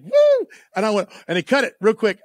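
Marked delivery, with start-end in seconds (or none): 0.0-0.5 a sudden squeaky high yelp, much higher and louder; high mock yelp. Host mimics Tom Cruise's throw, high mock squeal. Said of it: "he throws like a girl, like".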